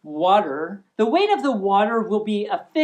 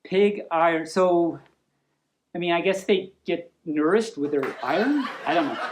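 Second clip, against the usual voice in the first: chuckling aside; 5 semitones lower than usual. Real, not acted. Laughing aside about pig iron.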